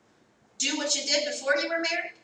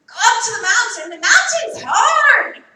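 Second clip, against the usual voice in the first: louder, higher, hammed-up protest, stretching hard; mock whining complaint; 5 semitones higher, 11 dB louder than usual. Preacher mocking a reluctant believer, whining complaint.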